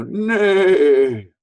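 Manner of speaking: high, loud whinny; horse neigh. Shown as a demonstration. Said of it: "They go".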